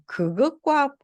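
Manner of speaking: ordinary talk, nothing marked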